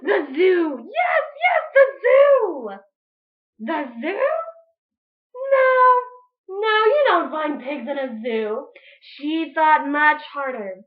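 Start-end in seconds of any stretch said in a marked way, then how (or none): 0.0-2.7 high, loud thrilled cry; excited witch. Acted. Witch Grinch cheering her own idea, high and loud. 3.6-8.7 higher scoffing no; witch voice. Acted. Witch Grinch rejecting her own plan, higher.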